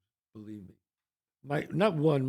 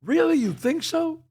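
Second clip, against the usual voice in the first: high, incredulous "Really?"; sarcastic disbelief; 10 semitones higher than usual. Real, not acted. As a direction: scoff at the idea, pitch jumping up. Sarcastic disbelief at wannabe candidates.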